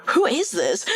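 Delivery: normal talking voice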